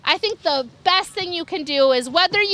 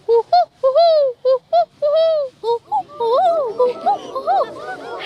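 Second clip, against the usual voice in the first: barred owl hoots; 9 semitones higher than usual. Shown as a demonstration. Showing kids the barred owl call, high hoots. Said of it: "And then barred owls".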